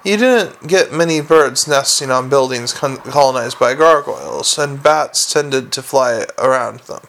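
ordinary delivery, nothing marked

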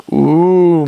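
ordinary delivery, nothing marked

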